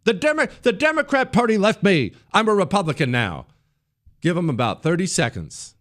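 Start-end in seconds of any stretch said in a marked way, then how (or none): 0.0-3.5 much higher, fast mocking impression; fake convert. Career leftist pretending to switch sides, much higher mock voice. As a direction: ham up a phony turncoat pledging loyalty. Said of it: "pretend to be on our side".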